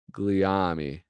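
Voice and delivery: ordinary talk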